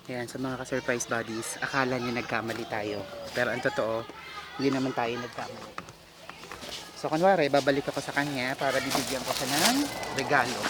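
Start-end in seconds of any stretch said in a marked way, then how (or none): 0.1-9.2 low, soft aside to the camera; whisper-talk it to viewers conspiratorially; hushed aside. Real, not acted.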